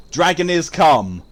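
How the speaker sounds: slow, loud mock French accent. Streamer quoting French-accented Mercedes to viewers, exaggerated French accent. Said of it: "has a French accent, and she said".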